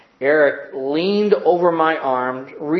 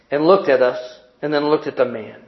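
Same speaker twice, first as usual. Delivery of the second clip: ordinary talk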